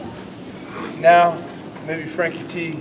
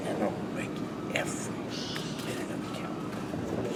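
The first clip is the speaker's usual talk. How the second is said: hushed promo line; 12 semitones lower, 18 dB quieter than usual. Shown as a demonstration. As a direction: lean in and breathe it. Promo demo dropping to a hush.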